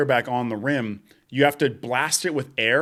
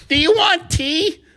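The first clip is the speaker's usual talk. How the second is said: high, slow, louder sweet granny; old lady voice; 5 dB louder, slower than usual. Retold or imitated. Old lady offering tea to cleaner, high granny voice. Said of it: "she's like".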